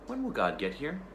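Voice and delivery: put-on girl asking the tiger when God comes; child's question. Girl asks about God.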